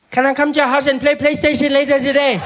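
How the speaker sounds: very high, put-on kid voice; little brother. Brother asking to play PlayStation, high mock child voice. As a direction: blurt it out like an oblivious small boy.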